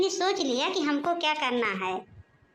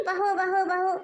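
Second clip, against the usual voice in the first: raised nagging call of bahu; mother-in-law calling बहू बहू with a raised voice; 4 semitones higher than usual. Acted. Mother-in-law calls बहू बहू to summon daughter-in-law, raised.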